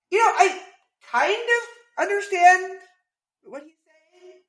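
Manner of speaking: high-pitched, tentative admission; doubtful hedging. Retold or imitated. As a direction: stretch it out high, unsure you really follow. Hedging how much he understood.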